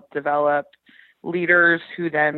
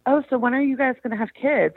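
quick, high, chirpy mock of a stranger; nosy small talker; 8 semitones higher, faster than usual. Guest quoting a random stranger's nosy question, mock chirpy voice. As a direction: mimic a prying acquaintance. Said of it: "making small talk says".

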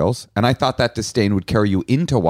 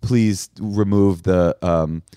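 slow pleading letter voice; much slower than usual. Retold or imitated. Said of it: "and saying".

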